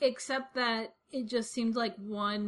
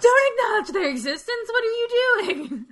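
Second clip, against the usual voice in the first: high, loud mock alarm; hammed-up alarm, higher and louder; mock panic; 12 semitones higher, 10 dB louder than usual. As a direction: scold in high fake horror. Mock alarm at addressing listeners.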